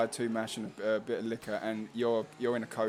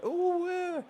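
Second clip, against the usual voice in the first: high-pitched mock fussy squeal; hammed-up namby-pamby voice; slower than usual. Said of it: "Bit mandy-pandy".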